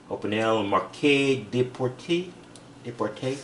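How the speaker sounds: slow, careful try at a French accent on "Opinel Marquet de Porte"; a modest French accent, drawn out and then repeated softly; attempted French accent. Shown as a demonstration. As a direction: sound out the brand name in an amateur French accent, slowly. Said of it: "as good as a technic can do a French accent".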